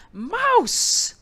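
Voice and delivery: loud, high bellow of the name; exasperated shout. A narrator's reading. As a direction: yell for the mouse. Bear catches him again.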